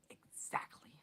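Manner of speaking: nasty whisper. A narrator's reading. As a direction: hiss it quietly. Vernon whispering in story.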